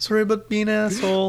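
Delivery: joining in with a high Canadian apology; mock Canadian accent. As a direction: play along as a polite Canadian thief. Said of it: "is that a good Canadian one".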